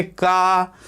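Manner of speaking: का drawn out slowly with a long आ; slow sound demo. Shown as a demonstration. Teacher slowly sounding out का for students. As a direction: stretch का to show its final vowel. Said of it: "अंतिम ध्वनि".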